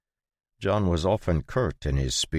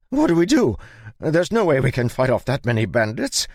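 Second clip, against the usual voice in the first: high, loud hammed-up panic; overplayed fright for show; mock panic; 10 semitones higher, 8 dB louder than usual. A narrator's reading. Gottfried putting on a show.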